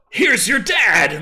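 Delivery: loud, slow hammed-up reveal; mock dramatic reveal. Describing the musical's twist to a co-host, in a loud mock-dramatic voice. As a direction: announce it like a cheesy stage twist.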